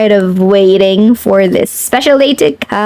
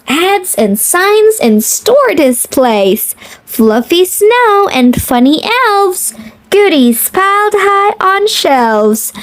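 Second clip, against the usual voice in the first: lilting picture-book read-aloud; reading to children; 5 semitones higher than usual. Acted.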